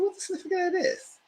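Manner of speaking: high, fast squeaky question; mock it in a shrill put-on voice; high put-on incredulous voice. Mocking comment on spiked shoes.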